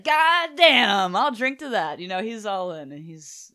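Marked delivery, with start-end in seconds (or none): 0.0-2.0 loud, high, whooping delight; hammed-up thrilled exclamation; really excited. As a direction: burst out as an overjoyed drinking buddy. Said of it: "he's really excited".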